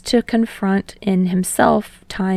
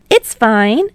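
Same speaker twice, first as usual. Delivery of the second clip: louder, bright and breezy; cheerful young man; 3 semitones higher, 6 dB louder than usual. A narrator's reading. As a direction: brush it off happily. Hinata voiced.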